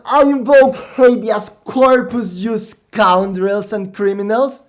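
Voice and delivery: high, loud, offended gnome voice; outraged gnome. Acted. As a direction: shriek his legal rights at them.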